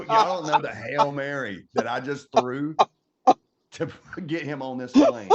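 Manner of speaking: laughing through the words; real laughter breaking the line. Real, not acted.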